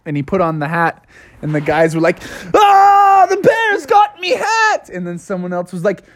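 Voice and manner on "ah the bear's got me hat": very high, slow crying shout; panicked wail. Acted. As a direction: wail in terror at the bear. Guys panic over hat.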